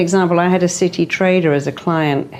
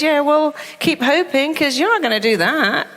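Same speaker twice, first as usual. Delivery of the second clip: much higher, taunting sing-song; mocking mind; 8 semitones higher than usual. The mind mocking someone who only hopes, high and taunting. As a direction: tease the hoper from up high. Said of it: "it goes".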